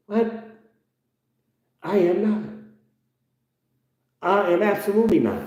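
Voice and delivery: high, protesting denial; indignant denial. Real, not acted. Denying he is evil to his aunt, high.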